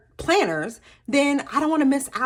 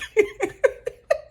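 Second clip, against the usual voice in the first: sudden high laugh at herself; amused laugh at her own silliness; high burst of laughter; 12 semitones higher than usual. Real, not acted. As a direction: let out a bright laugh.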